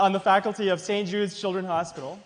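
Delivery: ordinary talk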